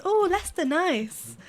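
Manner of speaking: high, impressed "oh" falling away; mock approval. Everyone reacting to her friend's hometown, mock approval. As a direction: imitate a pleasantly impressed stranger.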